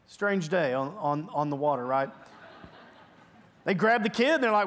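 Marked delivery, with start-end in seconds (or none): none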